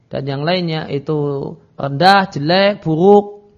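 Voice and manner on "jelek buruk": normal talking voice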